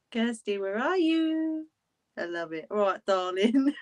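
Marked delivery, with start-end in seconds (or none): 0.1-1.7 high, drawn-out sing-out call; slow, lilting call in a raised pitch. Real, not acted. Calling out for missing Kirsty. 2.7-3.7 lightly put-on London accent; a hint of London. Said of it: "I'll say a London accent".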